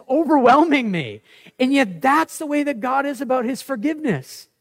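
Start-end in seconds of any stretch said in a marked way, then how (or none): none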